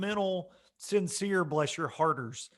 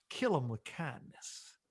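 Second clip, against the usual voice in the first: soft motherly quote; 4 semitones lower, 10 dB quieter than usual. Retold or imitated. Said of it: "my mom had a saying".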